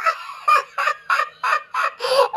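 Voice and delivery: mocking laugh. Monkey teases the scared duck, laughing. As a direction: giggle helplessly at your own joke. Monkey jokes about the giraffe.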